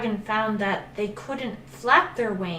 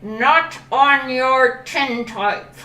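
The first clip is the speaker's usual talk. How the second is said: slow, drawn-out crocodile refusal; smug crocodile; 4 semitones higher, 5 dB louder, much slower than usual. Acted. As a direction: savour every word of the no.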